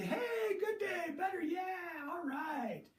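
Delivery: hammed-up delight at a good day; imitating a happy athlete cheering; mock cheer.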